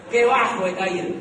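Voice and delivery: full-voiced mock despair; loud lament. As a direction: cry it out to the room. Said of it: "decía mi corazón".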